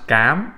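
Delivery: "cám" with a hard c, clear and rising; Vietnamese cám. Shown as a demonstration. Teacher isolates "cám" for the class. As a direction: model "cám" slowly for learners. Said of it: "the first word is pronounced".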